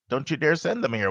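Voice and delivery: ordinary talk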